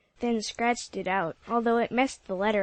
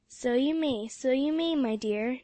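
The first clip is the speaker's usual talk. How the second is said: plain reading voice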